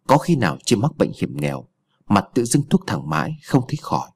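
plain reading voice

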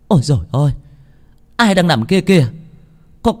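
high, startled calling out; alarmed cry. A narrator's reading. Grandmother spots a body by the village gate, alarmed cry. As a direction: cry out in alarm at a sudden sight. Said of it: "nghe bà nội hô như vậy".

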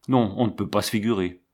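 usual speaking voice

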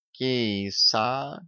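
soft, purring cat voice; softly purred lustful tomcat; lustful meow. A narrator's reading. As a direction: croon it with longing. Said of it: "сладострастно мяукнул он".

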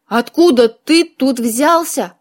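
slow, puzzled question; surprised. A narrator's reading. Witch finding her cat inside the circle, mild surprise. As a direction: ask it slowly, mildly taken aback. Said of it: "удивилась я".

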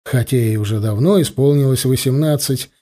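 ordinary delivery, nothing marked

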